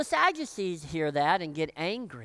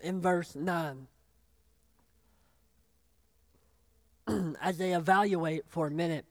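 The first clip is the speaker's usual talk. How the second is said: choked up; 4 semitones lower than usual. Real, not acted. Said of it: "See, now I'm getting choked up".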